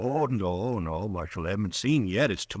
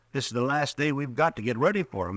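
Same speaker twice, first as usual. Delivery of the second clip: ordinary delivery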